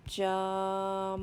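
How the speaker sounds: "Jumping" drawn out on one level pitch; slow word. Shown as a demonstration. Teacher says the word for learners to guess, slowly. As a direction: stretch "Jumping" slowly, holding the vowel and m. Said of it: "going to say the word slowly".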